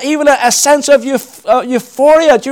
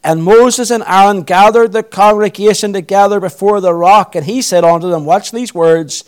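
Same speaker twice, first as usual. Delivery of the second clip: measured, solemn Bible reading; scripture reading; 5 semitones lower than usual. Acted. Preacher reading Numbers to the congregation, solemn and measured. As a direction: read the King James passage steadily aloud.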